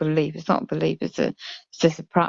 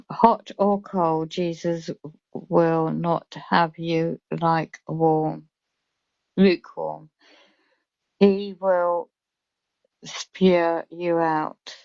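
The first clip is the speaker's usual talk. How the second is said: slow solemn reading; slower than usual. Shown as a demonstration. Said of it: "I'm just reading the comments".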